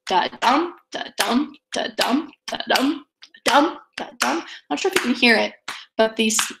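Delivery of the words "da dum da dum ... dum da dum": "da-dum" with a light da and a heavier, rising DUM; heartbeat rhythm. Shown as a demonstration. Teacher taps out Shakespeare's heartbeat, "da-dum" weak then strong. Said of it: "that we'd expect of a heartbeat".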